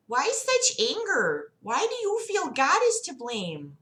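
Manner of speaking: high, singsong doctor voice; Sarah Palin. Acted. Doctor questioning her patient about God, high put-on voice. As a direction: pitch it well up, lilting questions. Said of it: "We'll just do Sarah Palin".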